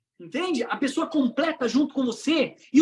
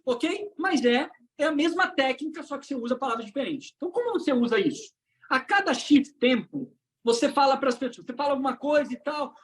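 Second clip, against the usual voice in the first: ordinary talk, nothing marked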